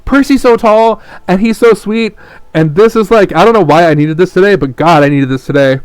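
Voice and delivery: high, loud, overwhelmed gushing; emotional gushing. Real, not acted. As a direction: gush like you're about to cry. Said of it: "I'm gonna fucking cry".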